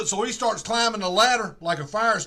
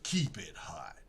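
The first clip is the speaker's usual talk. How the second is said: whispered quip; 13 semitones lower, 11 dB quieter, slower than usual. Retold or imitated. Detective's parting line, retold.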